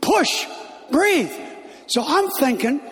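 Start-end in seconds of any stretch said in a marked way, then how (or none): 0.0-1.3 sharp high shouted commands; movie doctor yelling. Retold or imitated. A doctor in a movie yells at the woman in labor, sharp shouted commands. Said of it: "the doctor is just yelling at the woman".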